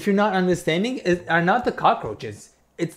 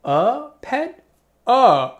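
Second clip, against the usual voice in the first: shocked high question; incredulous; surprised; 9 semitones higher, 7 dB louder, much slower than usual. Real, not acted. Disbelief at the word pet.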